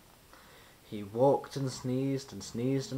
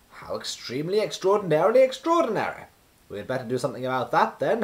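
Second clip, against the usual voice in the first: high, loud, then fast and eager; excited wizard; 4 semitones higher, 6 dB louder, faster than usual. A narrator's reading. Wizard amazed at sunless Coldland, excited. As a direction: marvel at it, then rush on.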